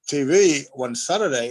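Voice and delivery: usual speaking voice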